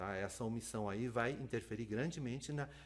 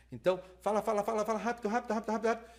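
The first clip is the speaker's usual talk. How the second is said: higher and louder, firing words off nonstop; rushing speaker; 8 semitones higher, 6 dB louder, much faster than usual. Pretend. Lecturer mimicking a speaker who rushes the interpreter, in a pushy rapid-fire voice. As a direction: play a speaker racing to fit it all in.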